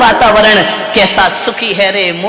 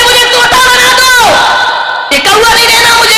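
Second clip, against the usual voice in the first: pleading crow; 12 semitones higher, faster than usual. Acted. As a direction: shout out the crow's wish to be a parrot. Crow begs saint to change.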